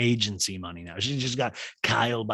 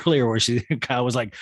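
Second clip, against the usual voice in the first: ordinary talk, nothing marked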